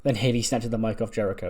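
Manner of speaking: ordinary talk, nothing marked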